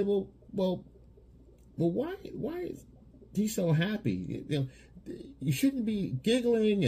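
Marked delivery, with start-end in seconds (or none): 0.0-4.5 high, mock-puzzled woman's voice; her suspicious question. Girlfriend puzzling over her giddy man, high mock suspicion. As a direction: play the girlfriend, high and doubtful. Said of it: "she's gonna say".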